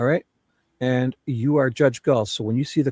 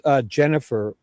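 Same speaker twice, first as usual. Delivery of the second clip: normal talking voice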